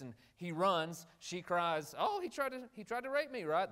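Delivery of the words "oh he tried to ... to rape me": a hammed-up accusing wail from Potiphar's wife; mock cry for help. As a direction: retell her false cry high and pleading. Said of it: "That's what she's yelling out".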